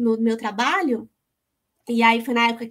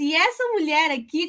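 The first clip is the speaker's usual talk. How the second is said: ordinary talk, nothing marked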